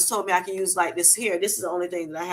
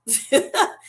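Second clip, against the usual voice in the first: short high laugh; a quick amused laugh; 6 semitones higher than usual. Real, not acted. Laughing at a retold remark.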